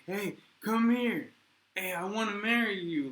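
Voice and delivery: sleazy come-on shouted at a stranger; pushy foreign creep. Retold or imitated. Expat mocked for hitting on Russian women, loud leering call. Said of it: "imagine coming to Russia and doing that".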